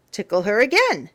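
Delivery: plain reading voice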